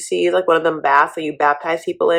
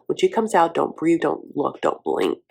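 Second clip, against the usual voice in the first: hushed warning; 2 semitones lower, 7 dB quieter, slower than usual. Retold or imitated. Brandon briefing Julia about Betty, soft hushed warning. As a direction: quietly coach her to stay still. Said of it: "gives her the lowdown".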